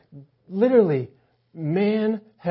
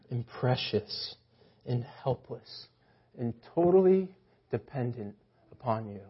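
tender, hushed; 5 semitones lower, 9 dB quieter than usual. Real, not acted. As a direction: let each word sink in gently. Tender description of babies.

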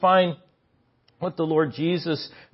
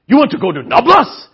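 loud, high, thrilled question; excited; 6 semitones higher, 10 dB louder than usual. Retold or imitated. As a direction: sound like the idea thrills you. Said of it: "He gets all excited".

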